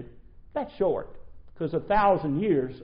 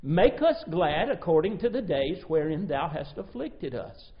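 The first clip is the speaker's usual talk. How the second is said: ordinary talk, nothing marked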